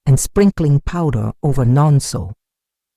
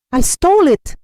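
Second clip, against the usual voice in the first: much higher, loud anguished confession; tearful shout; 13 semitones higher, 5 dB louder than usual. A narrator's reading. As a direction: cry it out to the whole market. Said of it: "he shouted, tears streaming".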